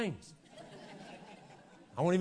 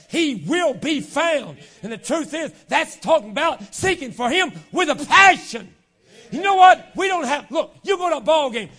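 loud, high, driving sermon delivery; full-force preaching; 8 semitones higher, 11 dB louder than usual. Real, not acted. Preacher urging the church to seek God with passion, full force. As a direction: hammer it home to the congregation at full volume.

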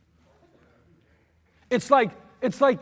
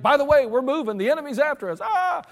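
raised, hurried alarm call; urgent wake-up call; 6 semitones higher, 4 dB louder than usual. Retold or imitated. Sergeant rousing sleeping troops, urgent and raised. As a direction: rouse the troops in a hurry.

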